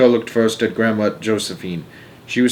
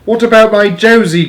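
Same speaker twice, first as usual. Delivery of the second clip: high, loud question; anxious cry; 11 semitones higher, 7 dB louder than usual. A narrator's reading. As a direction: cry out for your wife. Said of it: "cried Grandpa Joe".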